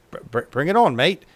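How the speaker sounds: hammed-up Aussie accent on "bring it on, mate". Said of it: "In, in their worst Aussie accent possible".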